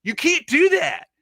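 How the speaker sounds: put-on high, loud, drawn-out protest in a boy's thick accent; imitating a disbelieving nephew, thick accent, loud and high; thick young-boy accent. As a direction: yell it like a kid with a thick accent. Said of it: "like, the thickest young boy accent".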